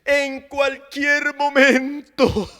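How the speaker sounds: full-force sermon delivery; preaching. Acted. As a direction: preach it with rising fervour.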